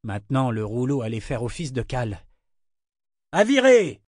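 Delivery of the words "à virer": high, loud, short command; barked order. A narrator's reading. Foreman ordering the men to heave, shouted order. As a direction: shout the command over the waves. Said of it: "ordonna Brachen".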